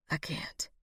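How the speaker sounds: very faint, breath-only reply; dying whisper. A narrator's reading. Dying man answering his lover, barely whispered. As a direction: give up in a weak whisper.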